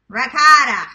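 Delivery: loud Queens ricotta, ricotta as rigotta; New York accent. Shown as a demonstration. Vlogger belts out ricotta while layering lasagna. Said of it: "I know, but, like".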